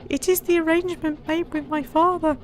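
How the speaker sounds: a lad explaining himself; boy voice. Acted. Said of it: "came the reply".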